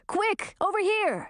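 high, urgent calling. A narrator's reading. Wizard calls Gracina to shelter, urgent call.

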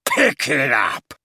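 bellowed order, drawn-out slow. A narrator's reading. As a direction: bellow it like a furious father. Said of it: "Gaunt bellowed at her".

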